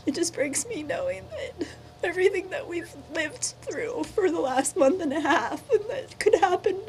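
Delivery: pleading through tears, words breaking; crying. Real, not acted.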